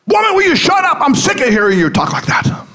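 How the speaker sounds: high, exasperated husband's outburst; fed-up husband cracking, voice shooting up; losing it. Acted. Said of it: "he lost it".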